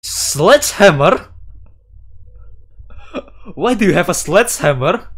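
high, loud and fast, shocked at the weapon; loud, high, rushed alarmed question; shocked disbelief. Acted. Shocked at her sledgehammer.

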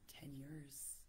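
soft whispered emphasis. Real, not acted. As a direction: whisper the weight of it. Emotional point about stores.

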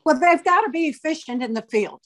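ordinary talk, nothing marked

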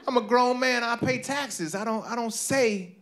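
high-pitched, insistent objection; indignant protest. Acted. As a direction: insist on being an adult. Protesting to fiancée, acted argument.

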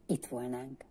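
a quiet whisper at the door. A narrator's reading. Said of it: "suttogta Monzen néni". (halk suttogás)

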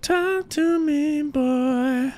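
very high, slow squeaky call; silly high voice. Pretend. Streamer talking to his dog in a very high, slow voice. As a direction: squeak it out slowly like a cartoon.

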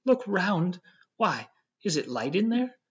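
high, loud burst of relief; relieved cry. A narrator's reading. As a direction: call out, suddenly relieved. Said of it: "in a tone of relief".

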